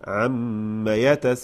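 عم يتساءلون without an added alif, chanted slowly; correct recitation. Shown as a demonstration. Teacher demonstrating the correct reading of عم. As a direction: say عم short, no alif, then chant. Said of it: "ما في ألف هنا".

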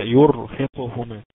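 loud, slow يرهقهما with a thick still R after "yu"; heavy ra. Shown as a demonstration. Teacher writing the example on screen, heavy ra. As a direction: recite يرهقهما drawn out, the ر full and vowelless. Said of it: "راء ساكنة وقبلها إيه".

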